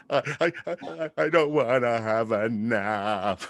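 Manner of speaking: put-on slow, whimpering little kid pleading; whiny four-year-old. Boy begging his dad to skip the nap, slow whiny whimper. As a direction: stretch it out like a sulking kid dodging a nap. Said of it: "his bottom lip starts doing this thing".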